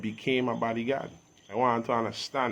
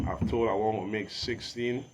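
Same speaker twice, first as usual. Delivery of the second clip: normal talking voice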